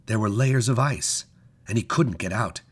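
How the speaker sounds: ordinary delivery, nothing marked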